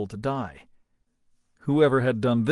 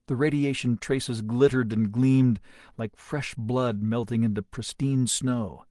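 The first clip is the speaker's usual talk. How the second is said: usual speaking voice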